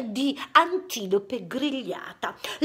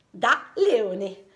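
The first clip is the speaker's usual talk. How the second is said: usual speaking voice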